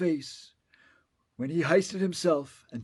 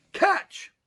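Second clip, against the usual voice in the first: sudden higher call; 13 semitones higher than usual. A narrator's reading. Onceler calls to the child as he throws the seed. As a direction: toss the word up high. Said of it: "calls the Onceler".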